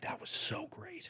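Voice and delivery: slow, near-silent whispered compliment; mock meek praise. Comic mimicking a sulky dad, slow whispered praise. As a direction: mumble it like a reluctant fan.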